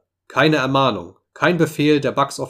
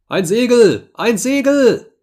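very high, loud cry, climbing on the repeat; screamed alarm; 11 semitones higher, 7 dB louder, slower than usual. A narrator's reading. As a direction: scream the sighting down from the mast. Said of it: "schrie es wieder". (gellend hoher, lauter Schrei)

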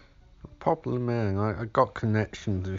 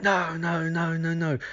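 mock panicked no; 8 semitones higher than usual. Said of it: "Laurie's like".

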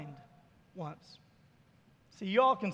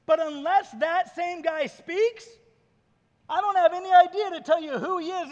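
higher, louder protest; acted exasperation; 5 semitones higher, 8 dB louder than usual. Healed man answering pointless questions, exasperated. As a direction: argue back emphatically, pitched up.

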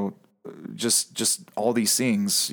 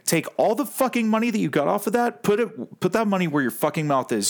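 heated rant; 7 semitones higher than usual. Real, not acted. Angry rant at a youtuber.

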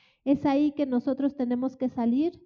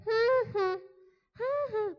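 high mocking "ja, ja"; a taunting cackle; mocking laugh; 10 semitones higher, slower than usual. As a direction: scoff at them with a sneering laugh. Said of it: "a todo el mundo cuando lo ve".

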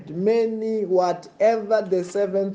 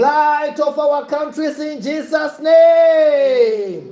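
fiery preaching; 8 semitones higher, 5 dB louder than usual. Acted. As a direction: proclaim it to the congregation.

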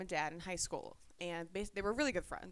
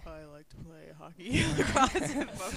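imitating deep voice; 8 dB louder than usual. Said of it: "his voice has gotten a little bit deeper".

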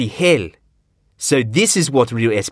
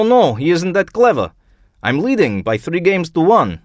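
put-on higher, lively old Russian voice; Ivanov voice; 9 semitones higher, 3 dB louder than usual. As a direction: tell the joke as a jovial old man. Ivanov tells the chess joke.

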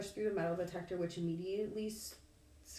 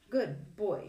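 praising dog; 3 semitones higher, 5 dB louder than usual. A narrator's reading.

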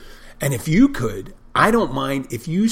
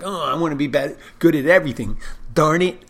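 louder, higher mock envious whine; half-joking petulant envy, a bit louder; jealous whine; 4 semitones higher, 6 dB louder, faster than usual. Bartender voicing his own envy of a coworker's cocktails, petulant whine. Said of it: "a little bit of jealousy".

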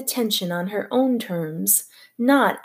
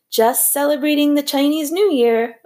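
higher, cheerful shout; 6 semitones higher, 3 dB louder than usual. A narrator's reading. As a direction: yell it gleefully over the chaos. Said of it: "Otis Amber shouted".

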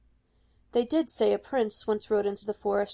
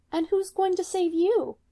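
sweet and much higher, with a hidden threat; sweetly; 9 semitones higher than usual. A narrator's reading. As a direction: ask sweetly, like a lure. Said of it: "she asks sweetly".

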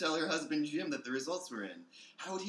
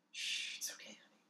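hushed soothing whisper; husband hushing his crying wife in a whisper; 11 dB quieter than usual. Acted. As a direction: shush her softly, all breath.

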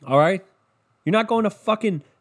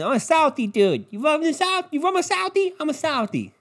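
higher Boston tough-guy voice; Boston accent; 12 semitones higher than usual. Retold or imitated. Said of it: "you know, Boston".

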